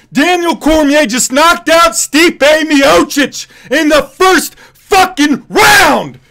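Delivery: raw, high, loud yelling in disbelief; ecstatic screaming. Real, not acted. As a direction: scream it like your fighter just won the title. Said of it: "that's just the unadulterated response".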